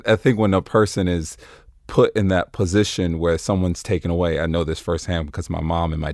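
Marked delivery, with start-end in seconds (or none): none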